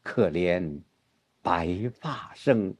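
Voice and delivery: low, soft, very slow verse; turn to lament. Shown as a demonstration. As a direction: sink into a hushed, drawn-out sigh of regret. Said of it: "很自然的就是". (低沉缓慢、悲叹地朗诵)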